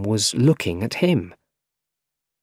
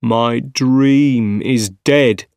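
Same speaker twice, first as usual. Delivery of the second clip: very slow, louder lament; anguished lament; 5 dB louder, much slower than usual. A narrator's reading. Scientist alone mourning his dream, anguished. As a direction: swell with grief, stretching each word.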